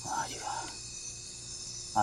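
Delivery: breathy whisper of pleasure; whispered delight. Real, not acted. Admiring the biryani with his brother: whispered wow. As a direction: savour it under your breath.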